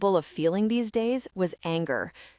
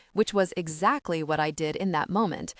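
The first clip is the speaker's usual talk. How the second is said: usual speaking voice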